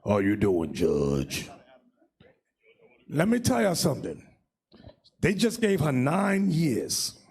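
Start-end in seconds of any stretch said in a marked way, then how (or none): 0.0-1.5 mock very deep, slow voice; exaggerated low masculine voice; imitating deep-voiced woman. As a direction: drop way down low and drag it out. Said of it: "She talk like this".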